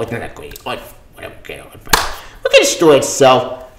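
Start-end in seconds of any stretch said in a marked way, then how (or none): 0.0-2.1 low, quiet, grudging shrug of a voice; sulky dismissal. Acted. To viewers, dismissing the comic with a sulky shrug. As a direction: mutter it like you've given up.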